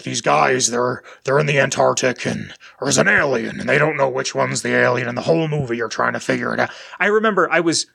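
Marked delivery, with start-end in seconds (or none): 0.0-6.7 low-pitched impression of a history teacher; deep-voiced teacher. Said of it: "he had this very deep voice".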